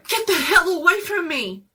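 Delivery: very high, loud scream; terrified yell. Acted. Bobby screaming at the zombie grabbing him, terrified. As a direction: scream it at the monster. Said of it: "Someone yelled".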